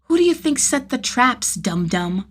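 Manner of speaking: put-on withering kid. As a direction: talk down to him like a smug boy. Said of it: "a withering look".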